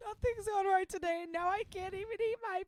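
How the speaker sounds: high, wailing mock sob voice; fake crying voice. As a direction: whine it like you're about to cry. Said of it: "That's my crying voice while I'm not crying".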